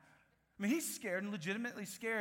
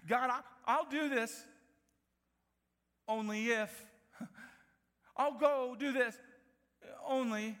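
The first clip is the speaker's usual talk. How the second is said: louder, higher pleading with God; mock bargaining prayer; 6 semitones higher, 6 dB louder than usual. Preacher imitating believers bargaining with God, loud. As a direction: haggle with God, half-mocking. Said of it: "are saying that to God".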